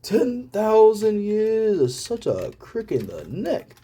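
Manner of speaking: high, slow Genie voice from Aladdin; Genie impression. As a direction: do Robin Williams' Genie, drawn out. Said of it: "sound like Genie from Aladdin".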